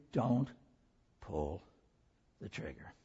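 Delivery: soft, low and intimate; hushed appeal. Real, not acted. Preacher quietly urging hurting women in the room. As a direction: lean in and murmur it. Same words to congregation.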